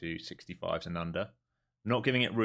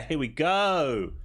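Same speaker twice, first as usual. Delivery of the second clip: drawn-out excitement; 8 semitones higher, 5 dB louder, much slower than usual. Real, not acted. Price reaching target, drawn out.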